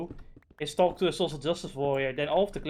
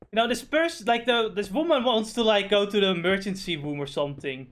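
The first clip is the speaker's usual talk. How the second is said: normal talking voice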